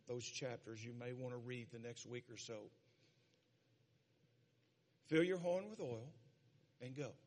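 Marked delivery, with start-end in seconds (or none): 5.1-7.1 scripture reading. Acted. Reads God's words from scripture.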